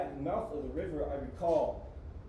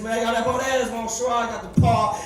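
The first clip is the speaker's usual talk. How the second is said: Papa voice; 5 semitones higher, 9 dB louder than usual. Retold or imitated. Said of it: "like Papa says".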